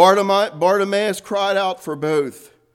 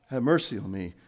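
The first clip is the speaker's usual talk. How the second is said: low, soft and unraised; subdued, matter-of-fact request; understated plea; 10 semitones lower, 8 dB quieter, faster than usual. Shown as a demonstration. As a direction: say it quietly, with no cry in it. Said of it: "Bartimaeus just didn't say".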